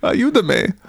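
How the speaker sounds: high mock plea in Spanish; play-acted cry for help; [name]. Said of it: "I can say it in Spanish also".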